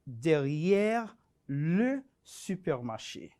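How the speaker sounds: derrière le supermarché said slowly in careful French; correct French pronunciation. Shown as a demonstration. Teacher models derrière le supermarché slowly for students. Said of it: "lo correcto es decir".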